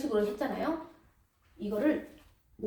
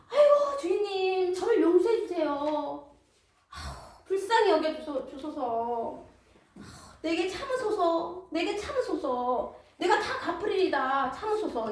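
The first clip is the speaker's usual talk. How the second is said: a higher, slow, archaic plea for patience; pleading servant; 5 semitones higher, slower than usual. Acted. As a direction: wail and beg for more time. Debtor pleads again.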